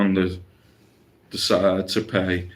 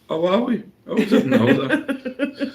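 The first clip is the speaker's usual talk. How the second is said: mock clueless tourist; 14 semitones higher than usual. Mimicking oblivious tourist in Napoli.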